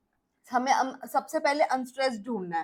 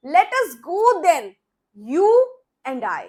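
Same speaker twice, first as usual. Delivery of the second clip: slow, louder poetic reading with a laugh at the start; dramatic recitation; 5 dB louder, much slower than usual. Shown as a demonstration. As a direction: savour each word as if performing the poem. First dramatic reading of poem.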